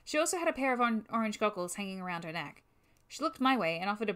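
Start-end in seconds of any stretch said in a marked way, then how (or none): none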